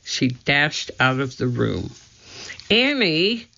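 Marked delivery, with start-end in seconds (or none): 2.7-3.5 calling out. A narrator's reading. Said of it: "called Jack".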